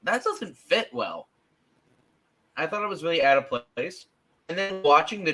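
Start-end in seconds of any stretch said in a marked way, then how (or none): 0.0-1.3 retold surprise. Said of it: "I was like".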